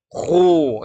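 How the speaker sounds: KHU thick but with lips pushed out; wrong pouted khu. Shown as a demonstration. Teacher lists readings to avoid, pouted khu. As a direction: say "khu" rounded, in a pout. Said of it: "tebal tapi monyong".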